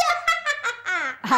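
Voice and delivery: drawn-out cartoonish "ha-ha-ha"; playful, put-on giggling run; gleeful character laugh. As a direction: laugh with silly delight. Delighted guess about ice cream.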